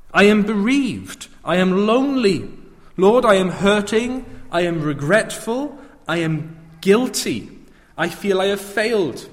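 high, loud, impassioned lament to the Lord; anguished plea. Acted. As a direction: cry out each confession to Jesus with rising urgency. Voicing believers' anguished plea to Jesus.

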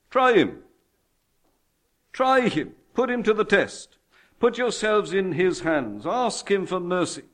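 high, emphatic pulpit appeal; urgent preaching. Real, not acted. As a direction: urge the congregation with punchy commands. Urges the congregation.

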